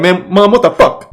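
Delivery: imitating an irritated son; exasperated at mom. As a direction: throw up your hands at mom. Imagined outburst at his mom.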